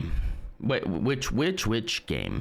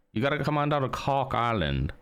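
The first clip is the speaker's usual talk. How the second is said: higher-pitched, sing-song fake Cork accent; badly faked Cork lilt, pitched up; attempted Cork accent; 6 semitones higher than usual. Shown as a demonstration. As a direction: ham up a bad Irish accent from Cork. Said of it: "I can't do the accent".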